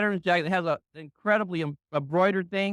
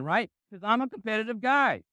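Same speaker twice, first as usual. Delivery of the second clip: ordinary talk, nothing marked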